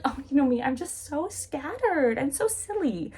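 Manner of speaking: high, drawn-out ditzy excuse; airy self-mockery. Isabella excusing her distraction to Catherine, airily. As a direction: brush it off in a put-on silly lilt.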